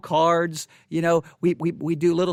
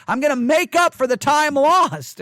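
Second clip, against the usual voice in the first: louder, higher, defiant; loud determined vow; 6 semitones higher, 6 dB louder than usual. Real, not acted. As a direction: declare it like a stubborn promise. Frustrated vow after sleepless joke.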